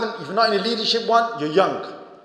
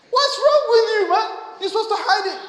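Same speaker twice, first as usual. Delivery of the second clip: high, loud, laughing scold; playful telling-off, pitched way up; mock exasperation; 13 semitones higher, 3 dB louder than usual. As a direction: tease him like a fed-up friend. Teasing the showy crier.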